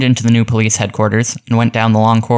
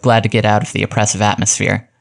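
ordinary delivery, nothing marked

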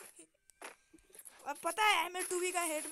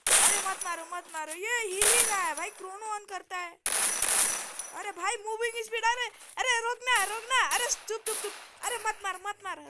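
higher, louder, urgent protest; frantic pleading; 7 semitones higher, 7 dB louder than usual. Real, not acted. Gamer begging other players not to shoot, frantic pleading. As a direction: cry out in a rapid, high-pitched panic.

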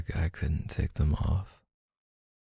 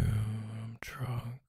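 whispering; 4 semitones higher, 10 dB quieter than usual. Acted. Drunk partner coming home whispering.

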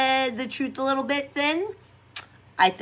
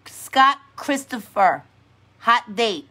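ordinary delivery

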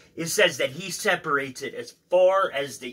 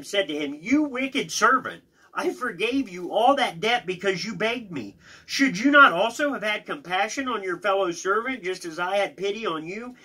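raised, emphatic Bible reading; scripture reading; 7 semitones higher, 4 dB louder than usual. Acted. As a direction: read the parable out with force. Reads scripture aloud.

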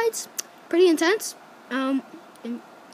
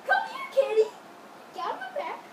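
squeaky coaxing voice; 10 semitones higher, 9 dB quieter, faster than usual. Acted. Coaxing the cat.

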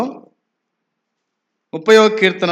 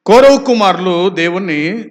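ordinary delivery

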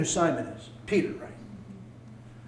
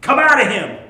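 stern rebuke; 3 semitones higher, 8 dB louder than usual. Acted. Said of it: "Jesus said".

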